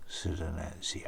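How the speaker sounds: usual speaking voice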